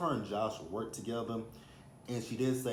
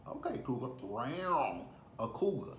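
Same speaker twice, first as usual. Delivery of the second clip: drawn-out mock growl on the middle word; slow, playful big-cat rawr; playful cougar teasing; 3 semitones higher than usual. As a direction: tease her like a prowling cougar.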